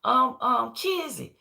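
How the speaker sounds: a touch of hoping to be remembered; old Kizzy hopeful. Retold or imitated. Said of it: "She said".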